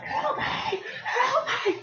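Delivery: tiny, very high squeak; mock plea for help. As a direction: squeal it softly in pretend distress. Acts out a cry for help.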